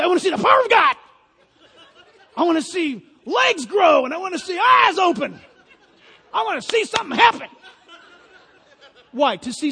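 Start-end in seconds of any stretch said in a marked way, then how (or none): 0.0-7.5 high, loud, laughing mimicry of thrill-seekers; mocking eager believers. Preacher mocks people who only want miracles, shouting and laughing. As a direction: ham up a giddy miracle-chaser begging for signs. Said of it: "A lot of people say".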